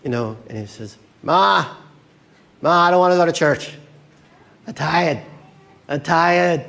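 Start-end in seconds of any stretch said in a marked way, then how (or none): 1.2-6.6 hammed-up tired son; whining son. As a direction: complain like a kid dragged out of bed. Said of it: "the son who wakes up".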